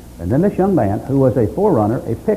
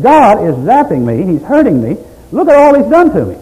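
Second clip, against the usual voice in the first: anguished outcry; 8 semitones higher, 6 dB louder than usual. Retold or imitated. Job listing how God hurts him, building anguished cry.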